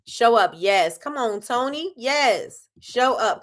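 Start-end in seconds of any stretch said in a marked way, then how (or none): none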